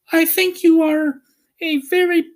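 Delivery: put-on high girlish voice for Dorothy; high young girl scolding the wizard; Dorothy's voice. A narrator's reading. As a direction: raise the voice for the little girl. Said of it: "said Dorothy".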